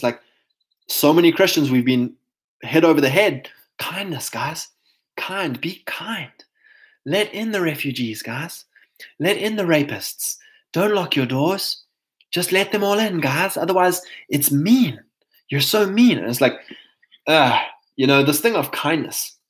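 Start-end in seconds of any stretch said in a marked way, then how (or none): 3.8-8.6 hushed, sugary mock whisper; mock sweet preaching. Mocking Christians told to be kind, hushed sugary whisper. 9.2-16.2 higher, whiny sarcastic nagging; mock scolding. Mocking preachers who call locks mean, rising whiny sarcasm.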